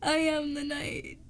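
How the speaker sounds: slow Batman line cracking into laughter; Batman line laughing. Acted. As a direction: try to be Batman, can't stop laughing. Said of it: "So my Batman impression".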